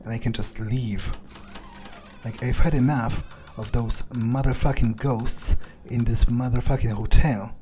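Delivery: normal talking voice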